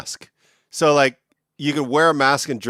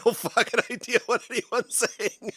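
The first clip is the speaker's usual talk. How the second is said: laughing; 9 semitones higher, 7 dB quieter than usual. Retold or imitated. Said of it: "And I was just like".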